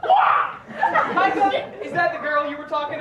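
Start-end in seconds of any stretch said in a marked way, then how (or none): 0.0-0.6 high, loud squawking wah; wah cry. Acted. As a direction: blurt out a wah. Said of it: "Start your sentences with "wah".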